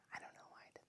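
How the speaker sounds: voiceless whisper, sheepish; embarrassed whisper. Real, not acted. Embarrassed aside to viewers, whispered. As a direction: whisper it like a confession.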